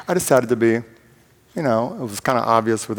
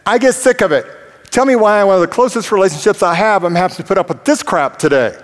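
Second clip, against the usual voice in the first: higher and louder, fast exasperated rant; retold outburst; 8 semitones higher, 3 dB louder than usual. Preacher retells snapping at roommate, loud fed-up outburst. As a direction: vent it all at once, fed up. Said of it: "I said".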